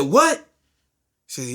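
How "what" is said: high, sharp cry of disbelief; shocked. Retold or imitated. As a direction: react in disbelief to terrible news. Shocked reaction to friend's death.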